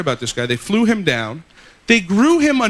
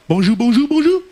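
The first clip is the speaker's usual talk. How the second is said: French hotel greeting; 5 semitones higher, 4 dB louder than usual. Retold or imitated.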